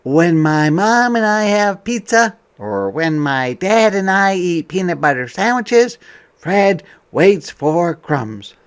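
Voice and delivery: bright, lively picture-book voice; reading to children. Acted. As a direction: read it out to a class of kids.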